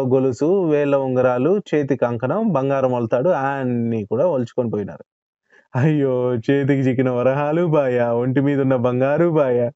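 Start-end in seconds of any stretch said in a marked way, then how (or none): 5.8-9.7 a voiced, grieving wail over lost gold; wailing lament. A narrator's reading. Robbed Dhanayya laments his stolen gold, wailing. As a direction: mourn the lost coins aloud. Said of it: "బాధతో లబోదిబం అన్నాడు".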